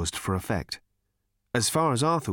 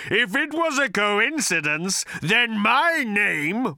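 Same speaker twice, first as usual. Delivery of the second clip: louder, higher, slow raging roar; 12 semitones higher, 4 dB louder, slower than usual. A narrator's reading. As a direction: roar with wounded pride. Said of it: "roared the voice".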